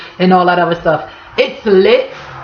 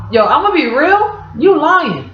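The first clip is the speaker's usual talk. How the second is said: pitched up, blunt callout; calling out a lie; 8 semitones higher than usual. Retold or imitated. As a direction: call them out, higher. Calling out a liar.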